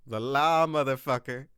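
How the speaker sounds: tough one-liner. Acted. Cop one-liner to the suspect.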